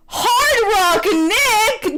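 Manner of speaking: high, very slow drawn-out reveal; dramatic exclamation. Acted. Narrator revealing the villain, a drawn-out high exclamation. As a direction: stretch the name out like a big reveal. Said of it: "the duo exclaims".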